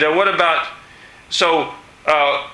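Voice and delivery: ordinary talk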